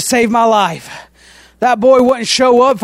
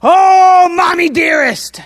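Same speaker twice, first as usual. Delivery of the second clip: put-on child crying out; 5 semitones higher, slower than usual. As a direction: wail for mommy like a scraped-knee kid. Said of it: "what's the first person they cry out to".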